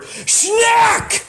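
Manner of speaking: a sudden very high shout of the new word; triumphant shout. Acted. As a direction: blast the made-up word out proudly. Comic shouts invented swear word.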